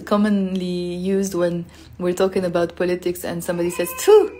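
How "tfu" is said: high, loud burst of mock spitting; sharp "tfu" of contempt; disgusted spitting sound. Shown as a demonstration. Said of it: "when you're disgusted".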